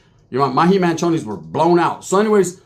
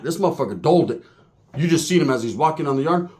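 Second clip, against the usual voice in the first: ordinary talk, nothing marked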